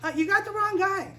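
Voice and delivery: high-pitched flustered refusal; acted nervous protest; protesting Moses. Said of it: "He said".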